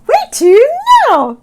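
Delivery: high, loud eager squawk; excited. Acted. Sammy asking Oscar where next, excited high squawk. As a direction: ask it bursting with excitement. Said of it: "Sammy asked excitedly".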